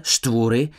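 usual speaking voice

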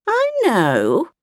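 a touch of scorn in the grandmother's voice; disdainful old woman. A narrator's reading. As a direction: grandmother brushing off the child's warning, mildly scornful. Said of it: "answered disdainfully".

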